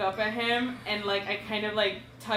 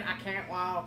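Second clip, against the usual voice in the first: put-on Southern accent on "I can't walk", a touch softer; southern NPC voice; 3 semitones lower, 6 dB quieter than usual. Girlfriend waddling in front of the game, Southern NPC. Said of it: "I just waddle in".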